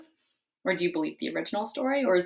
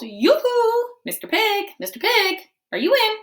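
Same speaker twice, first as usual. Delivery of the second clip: high loud yoo-hoo call; 7 dB louder than usual. Acted. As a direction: sing it out to the door. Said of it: "I called".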